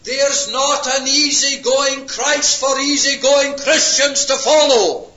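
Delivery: loud, high climactic preaching; full-force preaching. Real, not acted. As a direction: build the contrast to a roar.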